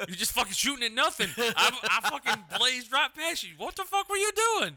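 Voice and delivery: mock his friend, laughing through it. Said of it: "He's like".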